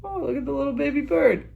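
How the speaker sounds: put-on high, cooing baby talk; high cutesy coo; cooing at a baby bird. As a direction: coo over a cute little bird. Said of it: "I was like".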